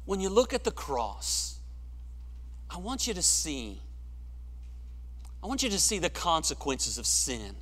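ordinary talk

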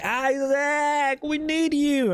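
high, hammed-up plea for a missing friend; pleading call. Retelling how the squad called for Isaac on Discord, high mock pleading. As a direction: call him back like you're begging. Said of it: "We were like".

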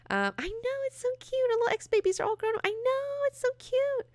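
very high squeaky cutesy voice, fast; squealing gush. Pretend. Fan gushing to viewers about the mutant council, in a very high squeal.

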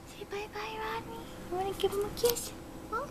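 very high cooing goodbye. Real, not acted. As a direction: coax the bird sweetly. Baby talk to birds.

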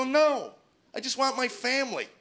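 high, loud acted plea; pleading cry. Wife answering her husband's rant, pleading cry.

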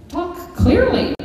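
high, slow squawked command; high commanding parrot squawk; bossy parrot. Retold or imitated. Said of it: "Alex commanded".

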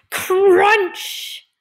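high, loud sound-effect word; exclaimed crunch. A narrator's reading. Reader voices the finger being smashed, loud exclaimed crunch. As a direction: burst out with a drawn-out crunch.

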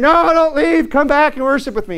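high, begging plea; mock desperate pleading; pleading Saul.

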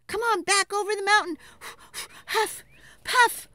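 high, fast hurried retreat; playful frantic dash; breathless rush. Acted. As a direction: race back home in a hurry. Racing back home.

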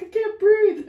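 real breathless laughter; laughing can't breathe. Real, not acted. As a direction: gasp it out mid laugh. Quoting while laughing.

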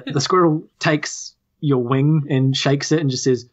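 ordinary talk

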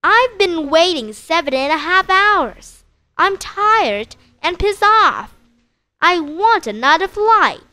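storytelling a furious complaint to the agent; hammed-up angry outburst; angry yell. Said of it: "You yell at the agent".

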